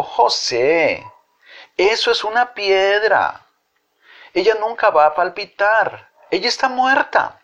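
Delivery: put-on child's voice. A narrator's reading. Child corrects José.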